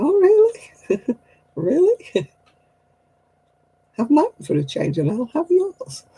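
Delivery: high, slow mocking really; sarcastic disbelief. To people moaning about sore backs, high sarcastic disbelief. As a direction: drip sarcasm at the whiner. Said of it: "And I'm like".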